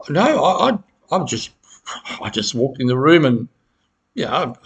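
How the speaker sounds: flustered defending. Shown as a demonstration. To the accuser, defending yourself in a flustered stammer. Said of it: "Do you defend yourself and go".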